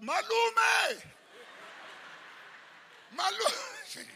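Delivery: high, loud calling out, last vowel held; retold shout. Niece shouting for her uncle in his matric class, high mock shout. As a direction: call out like a schoolgirl across a classroom. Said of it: "she will shout".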